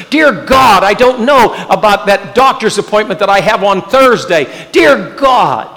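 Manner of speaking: impassioned prayer. Acted. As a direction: plead aloud, building to a shout. Voicing desperate prayers, shouting.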